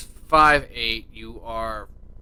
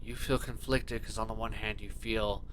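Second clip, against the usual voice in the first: soft scene narration; 5 semitones lower, 14 dB quieter than usual. A narrator's reading. GM sets scene softly.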